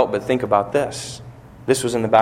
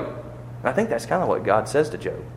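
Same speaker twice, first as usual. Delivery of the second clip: normal talking voice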